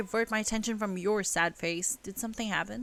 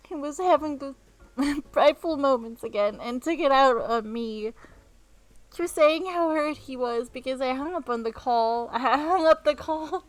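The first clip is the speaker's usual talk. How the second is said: higher, louder hurt complaint; indignant rant; 7 semitones higher, 9 dB louder than usual. Acted. Elle venting to James about her boyfriend, indignant. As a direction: vent it, laughing through it. Elle ranting, laughing between.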